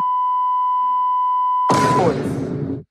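long, very high squealing laugh; high-pitched cackle. Real, not acted. Laughs after his airstrike bit.

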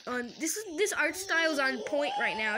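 ordinary talk, nothing marked

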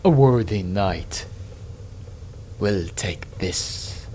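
slow, low, soft demon voice, hungry for the prize; lightly voiced eager demon. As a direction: savour each word like a greedy demon. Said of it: "says the demon eagerly".

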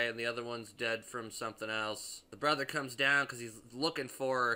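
usual speaking voice

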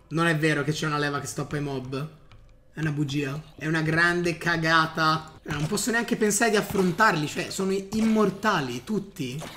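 ordinary talk

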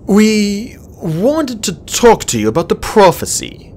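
put-on slow, hesitant young dragon. A narrator's reading. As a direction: ease into it carefully. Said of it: "he said hesitantly".

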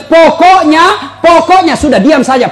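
husband imitating his angry wife's final word; nagging wife. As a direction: stretch pokoknya like a stubborn wife. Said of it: "Apalagi kalau dia bilang".

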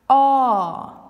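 Ah held open and loud like at the dentist; long open Afrikaans ah. Shown as a demonstration. Teacher demonstrating a long Afrikaans vowel, open wide. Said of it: "you're going to a dentist and he says".

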